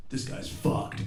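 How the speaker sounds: unvoiced aside; muttered verdict. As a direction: say it under your breath, flatly. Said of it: "one of the firefighters goes".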